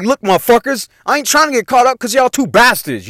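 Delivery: agitated warning. Retold or imitated. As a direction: retell laying down the law, worked up. Retells warning friends as cop arrives.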